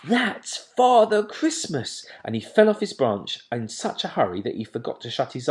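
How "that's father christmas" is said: high, slow, loud delight; thrilled owlet. A narrator's reading. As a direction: savour the big news. Plop thrilled.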